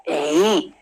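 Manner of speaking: ordinary talk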